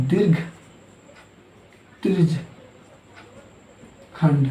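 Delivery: long pauses between single words; word-by-word dictation. Real, not acted. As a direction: say each word alone, as if writing it. Teacher spells out term while writing. (एक-एक शब्द रुक-रुक कर लिखवाते हुए)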